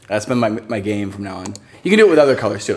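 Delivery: ordinary delivery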